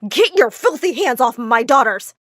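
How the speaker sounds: loud, protective snarl; angry order. Acted. Father ordering Dimitri away from his daughters, loud anger. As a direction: warn him off forcefully.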